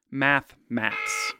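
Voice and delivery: ordinary delivery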